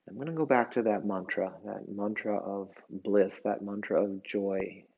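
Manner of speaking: soft, hushed meditation voice; quiet yoga teacher with long pauses; guided relaxation. Real, not acted. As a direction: ease them gently into drifting rest.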